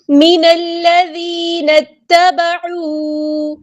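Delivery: من الذین اتبعوا slow and joined, hamza dropped; joined recitation. Shown as a demonstration. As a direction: chant it linked smoothly. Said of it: "نون کو ہم نے لام کے ساتھ ملا دینا ہے". (جوڑ کر آہستہ تلاوت)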